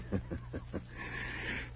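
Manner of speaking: soft breathy chuckle; a hushed, taunting snicker; quiet mocking laugh. Taunting the detectives they can prove nothing, a quiet laugh.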